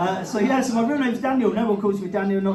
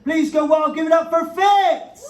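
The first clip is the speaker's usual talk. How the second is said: loud, higher hype shout, drawn out; compere's big intro; 5 semitones higher, 4 dB louder, slower than usual. Real, not acted. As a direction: bring him on like the main act. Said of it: "welcome this young man to the stage".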